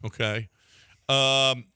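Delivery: ordinary talk, nothing marked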